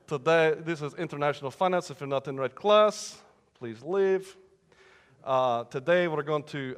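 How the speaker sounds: mock Russian-accented professor; bad Russian accent. Professor opening international finance class, bad Russian accent. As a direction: lecture in a thick fake Russian accent. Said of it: "don't like bad Russian accents".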